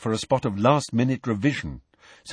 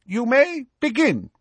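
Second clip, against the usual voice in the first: high examiner's announcement; much higher, formal command; calling out; 14 semitones higher than usual. A narrator's reading. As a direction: give the whole hall the order to start. Examiner starts the exam.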